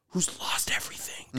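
whispered, intense; breathy dramatic whisper; hushed. Real, not acted. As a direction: lean in and whisper it. Hushed emphasis on tragic character.

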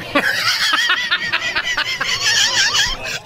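very high squealing laughter; shrieking laugh. Real, not acted.

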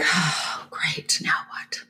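whispered sinking dread, trailing off. Retold or imitated. Passenger to herself as the attendant sits down, whispered dread. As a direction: breathe it out, bracing for trouble. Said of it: "kinda like".

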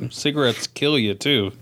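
ordinary talk, nothing marked

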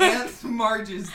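slow words through giggles; can't keep a straight face; laughing. Real, not acted. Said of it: "Serious mode".

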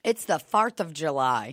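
"farth" with AR for OR; O words like R. Retold or imitated. Co-host joining the bit, "farth" said with AR. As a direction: mimic the accent on "farth", vowel as AR. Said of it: "said all her O words like R".

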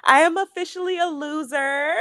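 high, loud, laughing and near tears; laughing proud announcement. Real, not acted. A proud new member tells her co-host, laughing loud and high. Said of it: "without further ado".

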